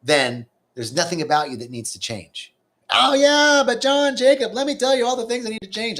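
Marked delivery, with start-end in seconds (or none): 2.9-6.0 high, loud mock protest voice; mock voice of a doubting student; mock whiny objection. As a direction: play the skeptic who wants to change everything.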